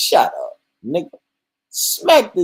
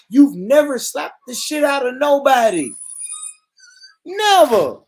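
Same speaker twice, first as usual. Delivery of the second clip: higher and louder, building to a yelled never; rising indignant shout; 11 semitones higher, 6 dB louder than usual. Real, not acted. Streamer shouting at UK viewers, building to an indignant yell. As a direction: push it up until never bursts out.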